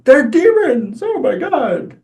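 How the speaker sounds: very high-pitched fake panic; mock alarm, sarcastic. Recarsons joking about demons, high sarcastic mock alarm. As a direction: squeal it as hammed-up, sarcastic horror. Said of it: "You know, that was sarcasm".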